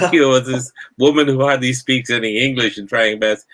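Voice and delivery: normal talking voice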